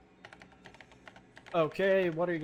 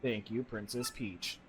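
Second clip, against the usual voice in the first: low, slow machine voice; a calm computer speaking low; lowered robotic TEC voice; 6 semitones lower, 8 dB quieter, slower than usual. Acted. TEC voice again.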